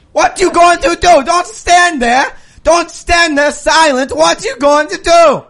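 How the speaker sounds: mock German accent. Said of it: "I am a German man".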